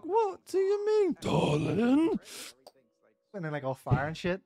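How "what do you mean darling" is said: very high, slow sugary lady; creepy sweet voice. Retold or imitated. Gamer imitating the unsettling lady, high eerie coo. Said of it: "That voice is very unsettling".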